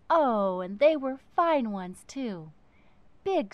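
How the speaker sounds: ordinary delivery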